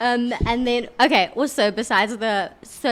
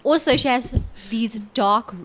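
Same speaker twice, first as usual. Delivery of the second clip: usual speaking voice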